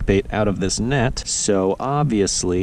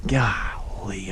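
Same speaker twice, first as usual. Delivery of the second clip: whispered golly; 7 dB quieter than usual. Real, not acted. Flustered after missed fish.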